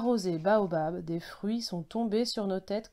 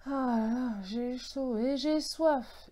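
slow, higher-pitched sigh of heat and thirst; saying aloud to oneself; 4 semitones higher, slower than usual. A narrator's reading. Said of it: "ai-je dit tout haut".

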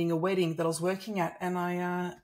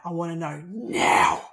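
louder, slow and accusing; fired up; 6 dB louder than usual. Retold or imitated. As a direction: confront him, pressing each word. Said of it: "I was so fired up".

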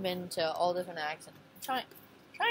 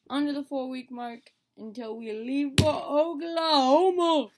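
slow build to a loud, high cheer; building hype; 5 semitones higher, 7 dB louder than usual. Pretend. Announcing the Oklahoma countdown, mounting excited cheer. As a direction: stretch it out and swell into the place name.

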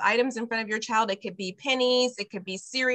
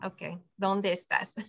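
normal talking voice

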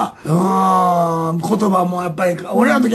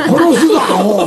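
mock threat; 3 dB louder than usual. Shown as a demonstration. Showing Osaka's murderous slang, mock angry. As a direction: act out a rough threat for laughs.

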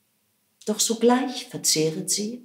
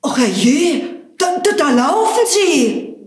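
high, loud cry of alarm; shrieking baker; 9 semitones higher, 8 dB louder than usual. Acted. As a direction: shriek it as the baker sees the bread run off. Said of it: "der Meister Bäcker schrie".

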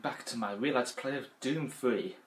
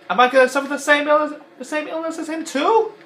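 much higher, louder mock worry; high, loud parody question; Mister Potato Head voice; 11 dB louder than usual. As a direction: wail it in the cartoon voice. Parody in the toy voice.

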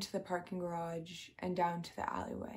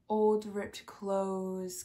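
normal talking voice

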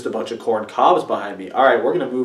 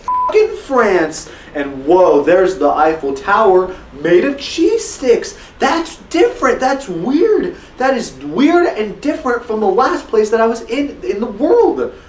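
wide-eyed tourist; 8 semitones higher than usual. Acted. Marveling at green screen backgrounds.

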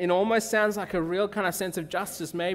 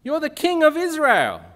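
loud, high, commanding rebuke; fiery Jezebel; 11 semitones higher, 9 dB louder than usual. Acted. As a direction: shake a sulking husband awake. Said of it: "to this husband of hers who's sulky and says".

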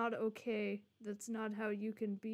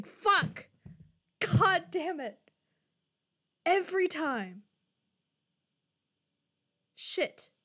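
much higher, louder curse; frustrated outburst; 6 semitones higher, 7 dB louder, slower than usual. Real, not acted. Streamer cursing when the game reopens, loud frustration. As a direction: blurt it out in sudden frustration. New window pops up unexpectedly.

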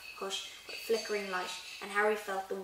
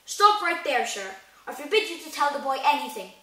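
loud, raised, forbidding order; commanding; 5 semitones higher, 9 dB louder than usual. A narrator's reading. Uncle Vernon forbidding Hagrid to tell, commanding. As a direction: forbid it at full voice. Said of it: "he commanded".

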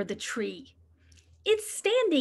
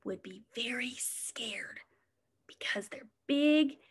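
whisper; 2 semitones lower, 7 dB quieter than usual. Acted. Spooky bear talk to kids.

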